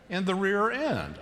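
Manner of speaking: ordinary talk, nothing marked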